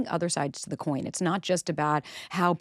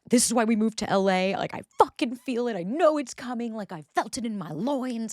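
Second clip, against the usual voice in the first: excited; 6 semitones higher than usual. Retold or imitated. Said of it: "I was like".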